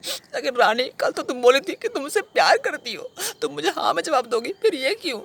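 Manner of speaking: high, whining hurt protest; anguished plea. Acted. Betrayed lover asks Rani why, high and distraught. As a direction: beg for an explanation in a high wail.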